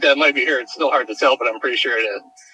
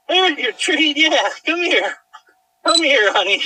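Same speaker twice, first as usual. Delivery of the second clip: excited dog greeting; 9 semitones higher than usual. Real, not acted. Owner greeting her found dog Cammy, excited high baby talk. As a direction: gush over the found dog.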